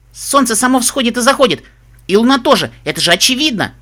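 high, loud excited cry; excited exclamation. Acted. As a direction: blurt it out, amazed at the question. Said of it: "воскликнула Бум". (взволнованный возглас)

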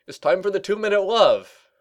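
high, loud, smiling call; cheerful announcement. A narrator's reading. Shining Armor summons colleagues to a ritual, cheerful call. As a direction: announce it brightly across the room. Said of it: "called across the room with a smile".

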